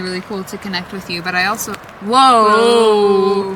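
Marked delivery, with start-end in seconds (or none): 1.7-3.5 loud sudden surprise; startled whoa. Real, not acted. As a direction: jump at the reading. Ghost meter jumps unexpectedly.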